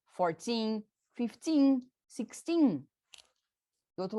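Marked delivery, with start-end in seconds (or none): 0.1-2.9 put-on fourteen, fifteen, sixteen, each with a long, strong final teen; stress on TEEN. Shown as a demonstration. Teacher listing the teens, stress on the last syllable of fourteen, fifteen, sixteen. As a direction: lean on every teen ending. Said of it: "o teen é o cara forte".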